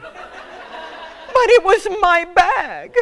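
high, loud, drawn-out whine; slow, high comic howl of protest; mock wailing protest. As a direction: wail it like a wronged child. Mock wail of protest to agent.

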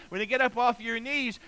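usual speaking voice

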